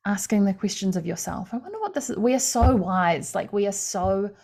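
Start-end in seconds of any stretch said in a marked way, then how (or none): 1.5-2.2 much higher, softer wondering voice; curious wondering. Pretend. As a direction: float the question up, softly curious. Voicing curious self-question.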